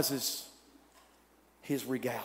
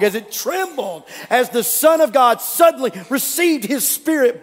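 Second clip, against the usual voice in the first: rising excitement; 6 semitones higher, 8 dB louder than usual. Real, not acted. Preacher building to resurrection joy.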